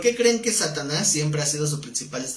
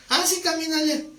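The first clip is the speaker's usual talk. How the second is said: high, mocking come-on, much higher; mocking evil being; 13 semitones higher than usual. An evil being taunting Peter to walk on water, mocking. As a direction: tease him into jumping with a sneer.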